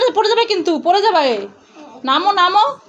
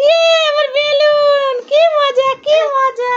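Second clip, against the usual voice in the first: higher, louder, slow squeal of joy; joyful cheer; 7 semitones higher, 3 dB louder, slower than usual. Pretend. Mother as her son, cheering the balloon is back. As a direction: cheer like a thrilled little boy.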